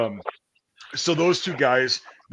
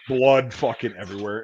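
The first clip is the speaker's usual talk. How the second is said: ordinary talk, nothing marked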